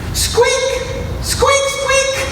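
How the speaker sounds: tiny squeaky mouse cries; high mouse squeak. A narrator's reading. Baby mouse squeaking in the snow.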